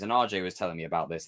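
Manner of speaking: ordinary talk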